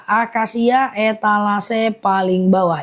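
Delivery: slow, deliberate repeat of "akasia etalase paling bawah ya"; slow and clear, like dictating to buyers; slowly repeated. Shown as a demonstration. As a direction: spell it out slowly for the viewers. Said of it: "aku ulang pelan-pelan".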